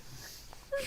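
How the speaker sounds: squeaky breathy giggle; quick high-pitched laugh. Real, not acted. Laughing at T-Rex fight.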